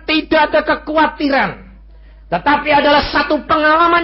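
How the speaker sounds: high, loud sermon proclamation; full force preaching. Acted. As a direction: proclaim it to the hall.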